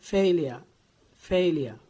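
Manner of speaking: loud, firm "Failure" with a long FAY; emphatic teacher's "failure", ending in -ya; model pronunciation. Shown as a demonstration. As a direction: drill "failure" as FAIL-ya, falling at the end. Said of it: "it should be failure".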